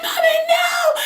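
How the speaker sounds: high, loud mock wail; hammed-up crying shriek; screamed pleading. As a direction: act out a child begging mom to stop. Child pleading during beating.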